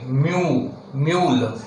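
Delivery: "mu", then "mule", clearly sounded; yu in mule demo. Shown as a demonstration. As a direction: model the yu glide in "mule". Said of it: "the sign for the U diphthong".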